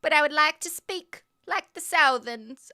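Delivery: mock her twelve-year-old self practising Southern; hammed-up kid Southern accent; childish Southern accent. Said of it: "and I'd say".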